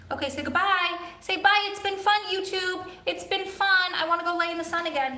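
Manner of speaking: high, cutesy talking-pet voice; dog's pretend voice. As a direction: speak for the dog in a high little voice. Voices the dog saying goodbye.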